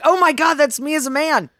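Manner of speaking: high, loud retold amazement; excited realisation. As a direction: gush in mock astonishment.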